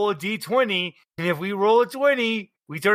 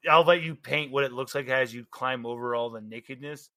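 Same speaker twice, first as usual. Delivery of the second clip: ordinary delivery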